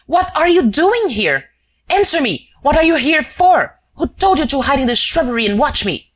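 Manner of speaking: high, loud furious shouting; enraged. Acted. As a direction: shake him with angry questions. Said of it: "overcome with rage".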